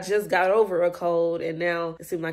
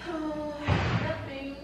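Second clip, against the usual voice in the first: prank moaning; 8 semitones higher, 4 dB quieter, slower than usual. Pretend. Answering the gang in a prank, moaning. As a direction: moan the words, pitched high and soft. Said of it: "moaning after everything that I say".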